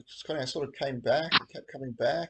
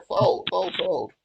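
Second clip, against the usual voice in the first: urgent pleading; 6 semitones higher, 7 dB louder, much slower than usual. Real, not acted. Urging opponents to fold.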